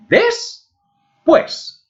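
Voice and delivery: Después split into high "des" and "pués"; syllable by syllable. Shown as a demonstration. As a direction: pause in the middle of Después. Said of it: "está formada por dos sílabas".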